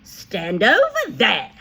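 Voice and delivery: bossy headmistress command; ordered. A narrator's reading. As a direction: order him like a sergeant. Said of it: "the Trunchbull ordered".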